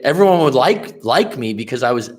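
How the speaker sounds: ordinary delivery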